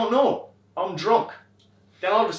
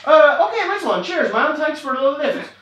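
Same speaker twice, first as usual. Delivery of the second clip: high, loud, nervous thank-you; relieved rider thanking the scary driver; nervous cheery thanks; 11 semitones higher, 9 dB louder than usual. Retold or imitated. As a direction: gush thanks to get out alive.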